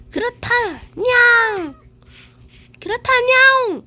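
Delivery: high cute cat meow reply. Pretend. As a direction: squeak like a cartoon cat. Voicing a cat villager.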